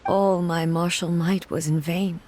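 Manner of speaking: ordinary talk, nothing marked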